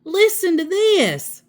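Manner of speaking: high, surprised "Listen to this"; amazed. A narrator's reading. Amelia Bedelia amazed at her teacher's list, high-pitched. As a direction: read it out amazed. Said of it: "she said".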